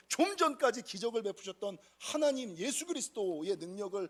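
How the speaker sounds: usual speaking voice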